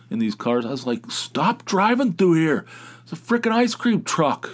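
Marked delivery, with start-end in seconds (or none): 1.1-4.5 fired-up yell. Retold or imitated. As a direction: yell at the drivers to stop. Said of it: "getting all fired up".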